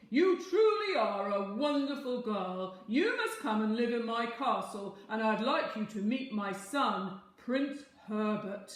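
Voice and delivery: loud, booming happy king; delighted king. A narrator's reading. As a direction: praise her grandly like a pleased king. King's voice.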